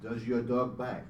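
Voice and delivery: slow, mock French accent like Inspector Clouseau; weird French accent. Retelling a Pink Panther scene, mock French accent. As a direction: ham up a French detective accent. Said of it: "with that weird French accent".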